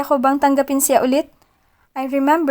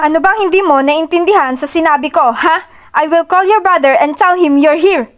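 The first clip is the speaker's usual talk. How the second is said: annoyed threat; 4 semitones higher, 5 dB louder than usual. Acted. Achilles threatening to call brother.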